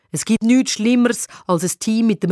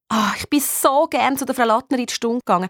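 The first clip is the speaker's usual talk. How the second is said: gushing sister; 2 semitones higher than usual. Acted. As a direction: gush fondly about a favourite teacher. Sister gushes about favourite teacher. (schwärmend)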